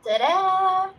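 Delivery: high, loud showy reveal; bright showing-off announcement. Real, not acted. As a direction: present it like a magic trick. Showing off her outfit on stream.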